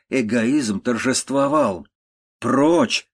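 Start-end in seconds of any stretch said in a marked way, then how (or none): 2.4-3.1 raised, louder cry of rejection; sharply raised voice, narrated outburst; angry cry. A narrator's reading. Countess driving her kneeling daughter away, angry cry. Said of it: "вскричала она".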